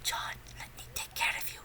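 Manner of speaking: imitated breathy seductive whisper.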